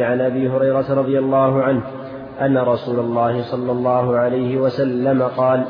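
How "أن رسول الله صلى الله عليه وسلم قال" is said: usual speaking voice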